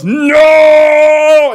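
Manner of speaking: huge, high, loud shouted NO. Retold or imitated. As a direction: blast the single word out. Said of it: "and he just goes".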